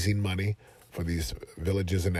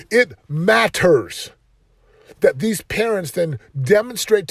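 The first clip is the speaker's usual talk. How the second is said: preaching at full force; 9 semitones higher, 8 dB louder than usual. Real, not acted. Coach preaching to podcast listeners, loud and fervent.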